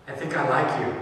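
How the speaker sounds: hint of a trembling voice; shaky nervous confession. Nervous boy confesses his crush, softly. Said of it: "this guy with this shaky voice, cracking voice".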